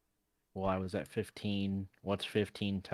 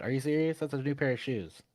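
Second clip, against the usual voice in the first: usual speaking voice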